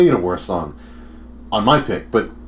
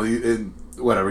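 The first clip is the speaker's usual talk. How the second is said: normal talking voice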